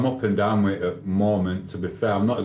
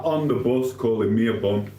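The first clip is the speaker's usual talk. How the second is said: normal talking voice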